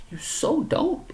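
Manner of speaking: slow, high, drawn-out scoffing retort; mocking retort. Scoffing at Brian during their argument, slow and high mock disbelief.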